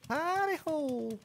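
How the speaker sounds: high, soft drawn-out singsong; high little echoing call; playful falsetto. Pretend. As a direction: croon it softly, way up high. Playful echo of hidey hole.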